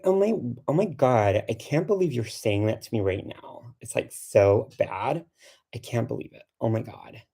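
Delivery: goofy exaggerated Valley Girl; hammed-up Valley Girl disbelief; Valley Girl accent. Shown as a demonstration. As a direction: play an incredulous Californian Valley Girl. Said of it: "my Valley Girl accent".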